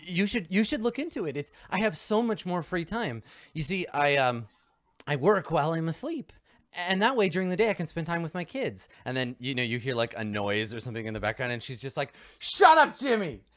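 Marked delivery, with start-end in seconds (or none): 0.0-8.9 higher, bright, gushing mom; cheery suburban mom. Acted. Mom gushing to the investigator about the implant, higher and upbeat. 12.4-13.4 put-on sudden high, loud yell at the kid; mom yelling. Mom yelling at her son in the background, very loud and high.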